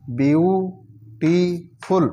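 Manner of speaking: Beautiful stretched slowly, syllable by syllable; slow syllable drill. Shown as a demonstration. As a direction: draw beautiful out in slow parts. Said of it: "syllable drilling". (Beautiful को धीरे खींचकर बोलना)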